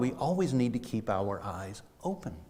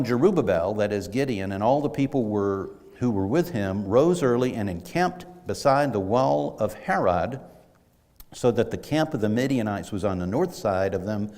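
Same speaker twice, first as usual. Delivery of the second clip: louder, measured Bible reading; scripture reading; 4 dB louder than usual. Acted. Pastor reading Judges aloud, louder and formal. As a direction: read the verse out to the congregation.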